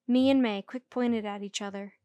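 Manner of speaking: ordinary delivery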